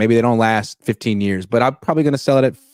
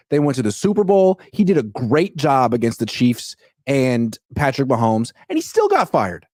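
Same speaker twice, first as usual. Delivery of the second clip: excited, high-pitched rant; 7 semitones higher than usual. Real, not acted. Heated rant about firing.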